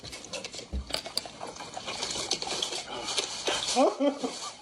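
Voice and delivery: a big burst of laughter. Real, not acted. Laughs after an insult.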